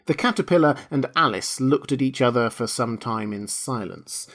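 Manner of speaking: usual speaking voice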